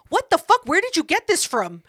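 high-pitched, dramatized disbelief; indignant confrontation. Retold or imitated. Girlfriend confronts boyfriend over recording, indignant. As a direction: demand an answer, voice jumping up.

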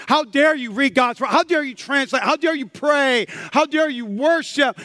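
loud, high, hammering rebuke; indignant accusation. Acted. Preacher voicing condemning labels put on believers, loud indignant challenge. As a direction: pile up each accusation forcefully.

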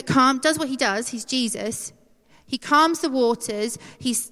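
normal talking voice

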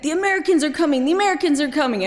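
mock Iraqi accent, fast and high; thick Iraqi accent. Kids prank-calling soldiers at midnight, thick Iraqi accent. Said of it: "in a really thick Iraqi accent".

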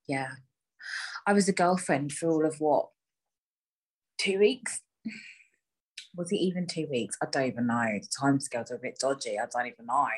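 ordinary talk

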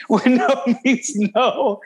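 amused, laugh-filled statement; real laughing. Real, not acted. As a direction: say it while cracking up. Laughing while siding with the child.

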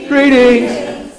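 high, projected greeting; called out to everybody. Shown as a demonstration. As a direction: hail the whole congregation. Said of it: "look out at everybody and go".

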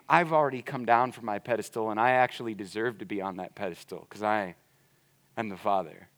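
soft, low, gentle pleading; hushed fatherly invitation; tender father. Acted. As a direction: coax him in quietly, like a loving dad. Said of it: "the father is going".

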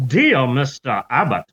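put-on Southern accent. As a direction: address him like a Southern gentleman. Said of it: "I'm giving him a Southern accent".

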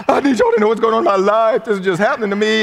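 high, loud, frantic plea; dramatic oversharing. Acted. Mimicking a Facebook post to everybody, melodramatic plea. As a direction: pour your drama out to everyone. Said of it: "we tell everybody".

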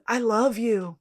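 mildly intense quoted "I love you"; imitated heartfelt confession. Fan re-enacting Mickey's first "I love you", mildly heartfelt. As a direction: act out a tender confession, lightly. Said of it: "The way you said it, stop".

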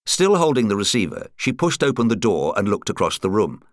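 usual speaking voice

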